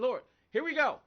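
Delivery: retelling a morning complaint to God about having to work; mock angry prayer. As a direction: vent at God, half joking. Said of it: "I was mad this morning".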